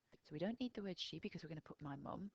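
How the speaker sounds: normal talking voice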